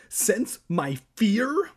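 high, loud, drawn-out question; slow, rising, high-pitched cry; incredulous cry. A narrator's reading. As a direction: echo it in squealed disbelief. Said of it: "Evan cried".

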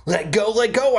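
high, understated acted scream; a half-hearted scream to be released; mild scream. A narrator's reading. Sammy struggling in Brent's grip, mild scream. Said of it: "I screamed".

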